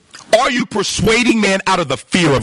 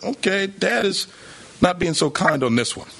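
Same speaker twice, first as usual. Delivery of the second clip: hint of a cautious child sizing up Dad, sinking lower at the end; wary little daughter; 5 semitones lower, 4 dB quieter than usual. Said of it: "she's afraid".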